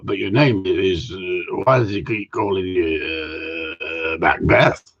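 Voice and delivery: slow, low, drawn-out mock Scottish; badly faked Scottish, halting and low; attempted Scottish accent. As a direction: fumble through a Scottish accent, dragging the words. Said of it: "I bet the Scottish people go".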